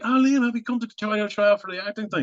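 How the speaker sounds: high-pitched imitation of the girl director; imitating the girl. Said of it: "And she was like".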